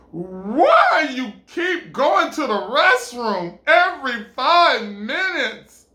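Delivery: loud, high, drawn-out question; exasperated demand. Acted. He confronts her over restroom trips, loudly exasperated. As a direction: stretch each word in loud exasperation.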